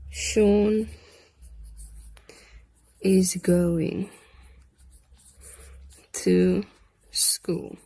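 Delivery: slow dictation, word by word with long pauses; dictating slowly. Shown as a demonstration. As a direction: spell it out for the class to write. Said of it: "teacher dictating word by word".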